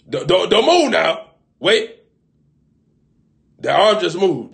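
high, loud sudden yell; mock shout. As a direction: yell when the arm moves. Shouting at someone who moved.

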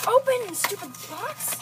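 high, loud exasperated command; frustrated. Real, not acted. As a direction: snap at the box in frustration. Struggling to open blind box.

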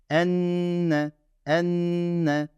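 Enne with the nn held long and nasal. Shown as a demonstration. Teacher shows students the held nasal in Enne. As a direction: hold the nn of Enne before the e. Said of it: "bu nunla mimde yapıyorum bu tutma işini".

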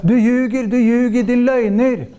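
high-pitched accusing chant; imitating an insistent heckler; mock crying out. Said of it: "stå og rope".

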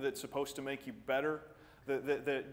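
ordinary delivery

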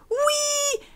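« Oui » crié très aigu; joie. Real, not acted. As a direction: exulte de soulagement. Trait finally acquired. (cri de victoire aigu)

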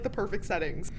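ordinary talk, nothing marked

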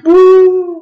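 huge jeering boo; loud boo. Acted. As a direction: boo him off the stage. Crowd jeers the new king.

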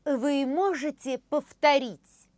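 usual speaking voice

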